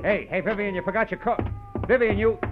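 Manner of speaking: high call after someone leaving; calling after her. Acted. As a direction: call her back before she's gone. Calling after departing woman.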